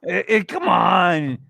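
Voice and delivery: slow, loud exasperated shout; drawn-out frustrated yell. Real, not acted. Exasperated outburst in argument.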